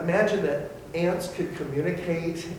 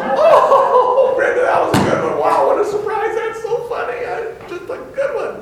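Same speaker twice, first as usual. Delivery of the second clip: much higher, laughing through the praise; laughing at a joke; 13 dB louder than usual. Retold or imitated. Congregation laughing at Brenda's funny joke, high and laughing. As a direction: chuckle along at Brenda's joke. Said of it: "And we go".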